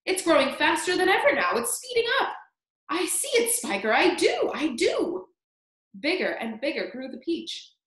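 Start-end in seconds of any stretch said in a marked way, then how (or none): 0.0-2.3 high-pitched, climbing amazement; excited shout. A narrator's reading. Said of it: "Aunt Spiker shouted". 2.9-5.3 high, squealing delight; excited agreement. Acted. Aunt answers in excitement.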